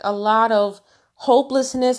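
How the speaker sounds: ordinary talk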